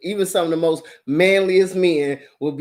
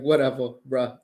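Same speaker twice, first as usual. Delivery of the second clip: dismissive; 7 semitones lower, 4 dB quieter than usual. Retold or imitated. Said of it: "she's like".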